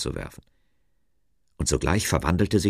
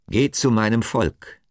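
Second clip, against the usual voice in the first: lightly voiced command, higher and louder; emphatic divine order; God, commanding; 6 semitones higher, 5 dB louder than usual. A narrator's reading. God sends Moses to his people, commanding.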